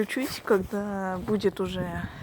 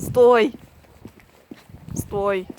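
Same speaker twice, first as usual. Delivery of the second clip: shouting; 14 dB louder than usual. Real, not acted. Shouting after the dog.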